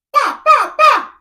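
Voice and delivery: loud, high-pitched mock gunfire; comic squealed "bah" shots; imitated gunshots. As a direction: yelp out three gunshot noises. Imitates gunshots of the British attacker.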